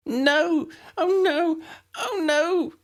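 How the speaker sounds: high wailing cries of panic; high sobbing despair. A narrator's reading. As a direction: wail it out in terror. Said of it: "she kept sobbing".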